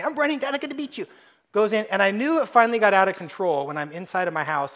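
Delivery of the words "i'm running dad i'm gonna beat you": put-on little girl. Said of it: "I'm like".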